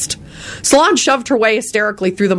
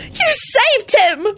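high, slow overjoyed screech; dramatic screech; much slower than usual. A narrator's reading. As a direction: screech with wild joy. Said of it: "screeched dramatically".

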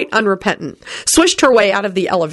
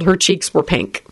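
plain reading voice

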